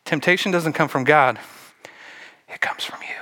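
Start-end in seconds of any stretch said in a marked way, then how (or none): 2.5-3.1 whispered, intimate punchline; pointed whisper. Real, not acted. Preacher telling the congregation where temptation comes from, whispered. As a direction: drop to a whisper to drive it home.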